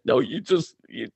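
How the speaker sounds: high-pitched whiny protest; mock upset kid. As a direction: imitate an athlete arguing back. Retold protest of upset athlete.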